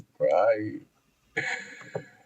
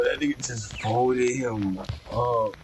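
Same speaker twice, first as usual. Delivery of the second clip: ordinary talk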